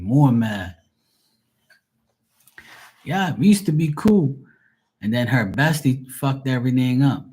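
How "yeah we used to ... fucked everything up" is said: ordinary talk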